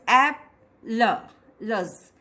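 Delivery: "app, la, luz" said as separate broken syllables, first one punched; syllables split apart. Shown as a demonstration. Teacher splitting a word for students, syllable by syllable. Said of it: "there are two syllables".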